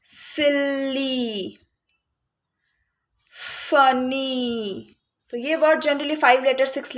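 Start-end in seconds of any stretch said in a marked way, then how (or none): 0.1-1.6 सिली very slow, final ee held; softer, drawn-out teacher demo of सिली; long E ending. Shown as a demonstration. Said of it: "Y को हम ई बना देते हैं". 3.7-5.0 फनी very slow with a held final ee; teacher stretching फनी to show its long ending. Shown as a demonstration. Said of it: "Y को हम ई बना देते हैं".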